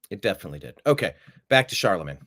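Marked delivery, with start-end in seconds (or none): 0.1-0.8 softer, lower repeat; quiet echo. Real, not acted. Repeating to himself, quietly confirming. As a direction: say it again under the breath.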